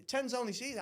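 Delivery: puzzled, rising repeat; baffled echo. Retold or imitated. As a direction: echo it back as if you understand none of it. Echoes it, not understanding.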